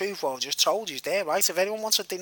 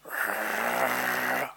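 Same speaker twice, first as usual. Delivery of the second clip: low imitated dog growl; 8 semitones lower, 7 dB quieter than usual. The dog warns the lad, a low growl. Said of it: "looks at him and goes".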